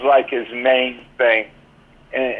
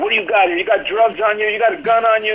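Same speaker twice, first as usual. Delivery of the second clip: mock yelling; 7 semitones higher, faster than usual. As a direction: imitate a cop barking questions at a suspect, half-yelled. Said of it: "he's yelling at me".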